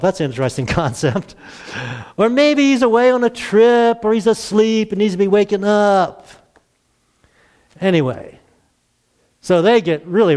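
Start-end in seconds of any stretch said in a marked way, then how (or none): 2.2-6.3 mocking taunt. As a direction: needle the false prophets with scorn. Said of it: "Elijah began making fun of them".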